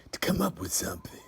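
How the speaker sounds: secret whisper. Acted. Friend whispering a plan about magic minds. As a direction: breathe it out like a secret.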